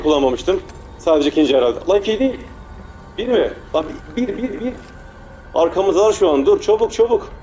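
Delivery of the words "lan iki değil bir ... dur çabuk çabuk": high frantic counting and urging; panicked rush. Real, not acted. Player rushing the puzzle with enemies behind, high and frantic. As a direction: scramble in a panic.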